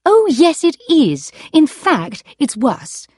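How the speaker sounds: high and loud, bitter; angry. Acted. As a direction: hit back hard at his excuse. Wife confronts husband about marriage.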